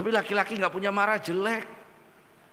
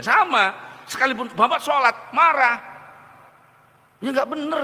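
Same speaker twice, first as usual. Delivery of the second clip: higher and louder, punching each phrase; thundering pulpit emphasis; preaching exclamation; 6 semitones higher, 6 dB louder than usual. Acted. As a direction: hammer home the point to the congregation. Preacher exclaims on praying angrily.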